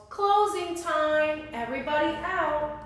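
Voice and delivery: a manager clearing the shop; calling out closing. Acted. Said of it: "the manager of the laundromat called".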